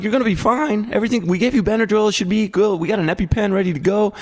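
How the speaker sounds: high-pitched, hurried mock reassurance; frantic reassurance. Dad to son who fears dying, frantic reassurance. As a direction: retell it as a dad desperately calming his kid.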